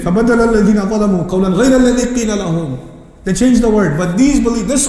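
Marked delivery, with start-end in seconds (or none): 0.0-2.8 very fast Quranic verse; quick recitation. Real, not acted. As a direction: rattle off the verse.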